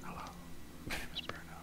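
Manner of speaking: soft, playful ASMR introduction; mock ASMR whisper. Player introducing themselves in the joke campaign, mock ASMR whisper. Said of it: "A S M R D and D campaign".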